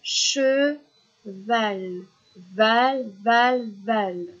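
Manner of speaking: "Cheval" split, "val" chanted in even beats; syllable drill. Shown as a demonstration. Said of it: "On va le refaire".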